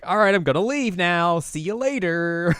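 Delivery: high, loud, stretched-out farewell; breezy goodbye. Retold or imitated. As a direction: sing out an overdone goodbye as you leave. Ren announces an awkward exit.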